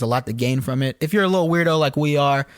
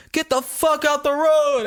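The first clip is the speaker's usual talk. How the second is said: slow, high-pitched retold yell; mock yell; 12 semitones higher, slower than usual. A truck driver yells at him in traffic, a slow high mock shout. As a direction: drag out a hammed-up trucker's shout. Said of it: "a person in the truck that yelled".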